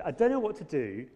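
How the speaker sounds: quoting EastEnders in a hammed-up Cockney accent. Preacher repeating the EastEnders line in a mock Cockney accent. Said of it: "that's kind of Cockney".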